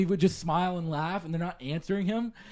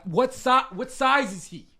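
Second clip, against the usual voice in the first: higher, slow, louder clerk asking across the store; loud shop clerk; 9 semitones higher, 5 dB louder than usual. Retold or imitated. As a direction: call out the size question for everyone to hear. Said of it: "so she's talking really loud".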